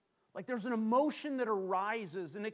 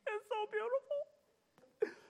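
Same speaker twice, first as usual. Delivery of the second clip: high, slow, squeaky mimicked sob; hammed-up tearful wail; mock weeping; much slower than usual. Husband imitating his wife crying on the ride home, mock weeping. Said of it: "I'd look over".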